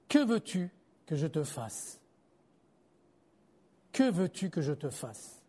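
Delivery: voix basse, douce, lente au début; question douce et posée. A narrator's reading. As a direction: demander posément, presque en confidence. Voix de Jésus lue à l'aveugle. (question tendre, dite tout bas)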